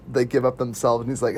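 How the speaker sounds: usual speaking voice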